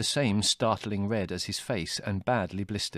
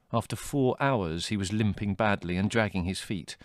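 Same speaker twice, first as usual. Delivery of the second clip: ordinary delivery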